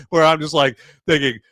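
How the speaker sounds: usual speaking voice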